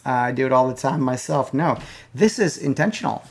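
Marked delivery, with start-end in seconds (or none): none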